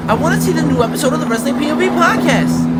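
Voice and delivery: loud yell back at the passenger; yelled skit line. Acted. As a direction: shout your excuse over the engine. Skit driver yelling back.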